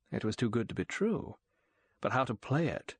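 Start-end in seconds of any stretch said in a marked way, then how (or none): none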